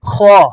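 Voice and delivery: "خا" thick and rounded, like "kho"; heavy kha. Shown as a demonstration. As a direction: round the vowel after the rough kh in "خا". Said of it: "موٹا کریں گے". ("خا" موٹا کر کے)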